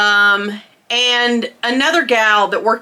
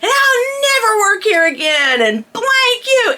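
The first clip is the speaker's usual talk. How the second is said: angry tirade; 12 semitones higher than usual. Retold or imitated. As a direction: slam the door on the job. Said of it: "unleashing all this tirade".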